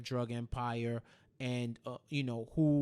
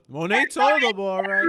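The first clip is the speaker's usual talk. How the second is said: loud, high emphatic retelling; mock stern warning; 5 semitones higher, 13 dB louder than usual. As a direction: belt out the quote like a stern boss.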